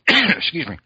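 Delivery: clearing a choked throat. Real, not acted. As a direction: recover from a choke. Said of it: "You're choking".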